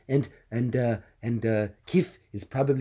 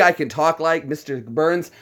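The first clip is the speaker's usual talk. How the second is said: ordinary delivery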